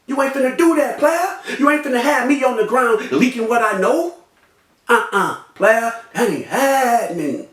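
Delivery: high-pitched, insistent retold challenge; challenging. Victim warns a hooded man in the store, challenging. He confronts the gunman in retelling.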